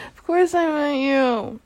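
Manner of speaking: laughing reassurance. Acted. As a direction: giggle it reassuringly.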